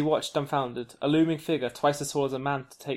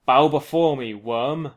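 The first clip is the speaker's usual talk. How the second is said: loud booming monster voice; thunderous command; 9 dB louder, slower than usual. Acted. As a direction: boom it out like thunder. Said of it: "a voice like thunder".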